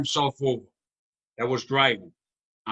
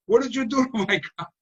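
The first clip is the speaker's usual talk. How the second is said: mock upset father; 9 semitones higher than usual. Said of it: "told him at work".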